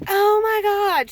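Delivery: high, slow, drawn-out mock gasp of horror; overdramatic panic. Nephew's girlfriend overreacting, in mock panic. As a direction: imitate someone blowing things out of proportion. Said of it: "she took everything to the extreme".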